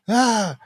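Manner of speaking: high yelp of pain; mock pain yelp. Buddy yelping after kidney punch, high mock yelp. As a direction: cry out as if punched.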